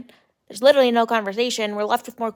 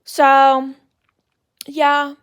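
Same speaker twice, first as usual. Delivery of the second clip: normal talking voice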